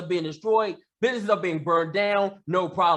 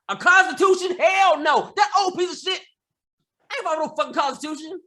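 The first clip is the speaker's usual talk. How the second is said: furious outburst; 7 semitones higher, faster than usual. Real, not acted. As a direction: spit out the contempt. Furious rant about the constitution.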